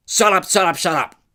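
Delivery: high, loud snapping shut up; angry shut up. A narrator's reading. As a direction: silence them harshly. Leader silencing the others.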